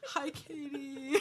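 slow, laughing greeting. Real, not acted. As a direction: draw it out through real giggles. Co-hosts giggling over missed greeting.

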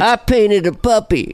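high, slow George Bush impression; kindly old man. Mocking Bush showing his painting, kindly old man. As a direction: play him as a harmless old fellow. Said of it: "now he's the kindly old man".

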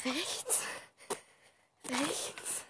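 softly hushed; barely voiced, hiding; quiet. Real, not acted. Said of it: "Wir müssen ganz leise reden".

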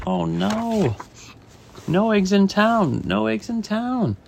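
high, drawn-out storybook dismay; dismayed chef. Acted. Chef finding no eggs in town, acted dismay.

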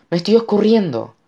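high, loud cry of alarm; grito. A narrator's reading. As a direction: scream as you are slipping. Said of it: "gritó su madre". (grito agudo de alarma)

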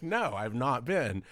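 high, loud, slowed laughing answer; giggly admission; laughing reply. Retold or imitated. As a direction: laugh it out, a bit embarrassed.

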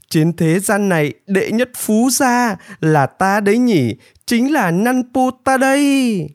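high, swelling self-praise; exultant boasting. A narrator's reading. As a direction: crow proudly, stretching the final words. Nanpu boasts he is the richest.

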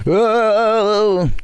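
high, drawn-out surprised whoa; overdone amazed reaction; exaggerated whoa. Shown as a demonstration. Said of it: "That's how I react".

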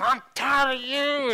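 high, slow mock rage; mad. Dad fussing at his cigarette, mad. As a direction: fume in exaggerated exasperation. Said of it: "he was so mad".

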